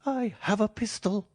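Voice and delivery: a scared man bluffing with a threat; reedy quivering voice. A narrator's reading. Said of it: "a reedy, quivering voice".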